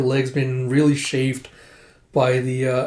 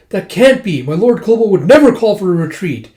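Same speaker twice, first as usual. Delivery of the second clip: loud, high-pitched protest; indignant raised voice; outraged disbelief; 6 semitones higher, 8 dB louder than usual. Acted. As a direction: protest loudly, refusing to believe it. Character refuses shocking news.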